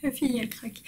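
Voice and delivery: ordinary delivery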